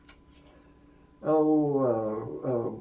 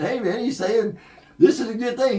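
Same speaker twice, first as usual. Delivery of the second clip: upbeat encouragement; 12 semitones higher, 9 dB louder than usual. Retold or imitated. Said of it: "I said".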